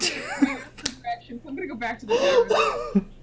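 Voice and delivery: talking while cracking up; laughing. Real, not acted. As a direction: giggle it out. Player laughing while speaking.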